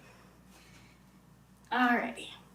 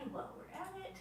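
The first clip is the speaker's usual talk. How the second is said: whispering; 6 semitones higher, 18 dB quieter than usual. Real, not acted. Softly checking the rising dough.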